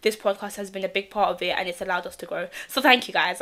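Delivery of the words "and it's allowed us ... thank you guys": ordinary talk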